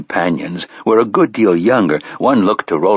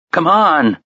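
urgent; 9 semitones higher than usual. A narrator's reading. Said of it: "the youngest man said in an urgent voice".

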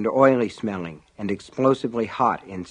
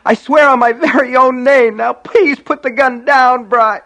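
high, loud, desperate begging; frantic child, cut off mid-plea; imploring cry; 13 semitones higher, 8 dB louder than usual. A narrator's reading. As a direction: implore your brother in panic. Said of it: "held his hands out imploringly".

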